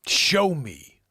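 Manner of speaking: loud, commanding mentor impression; Morpheus. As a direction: demand it like a film sage. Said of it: "He's like".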